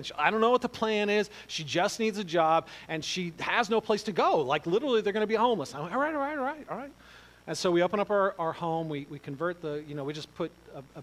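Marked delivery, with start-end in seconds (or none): 0.1-5.7 urgent pleading. Retold or imitated. Wife pleading for a friend. 5.9-6.9 reluctant giving in. Retold or imitated. Giving in to wife.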